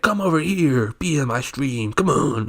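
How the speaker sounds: put-on American accent. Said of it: "like, a American accent".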